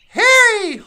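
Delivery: loud call across the woods. Real, not acted. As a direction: call his name out loud. Calling out to crying Harry.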